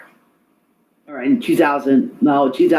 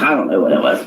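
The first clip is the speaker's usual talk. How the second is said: ordinary talk, nothing marked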